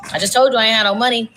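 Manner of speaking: ordinary talk, nothing marked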